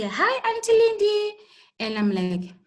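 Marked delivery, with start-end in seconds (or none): 0.0-1.4 much higher, louder sing-out hello; eager pupil's greeting. Pretend. Pupil greeting Auntie Lindy, high eager call. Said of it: "You say".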